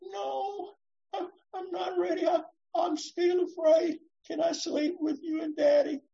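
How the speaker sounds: put-on a frightened child pleading to stay; scared child.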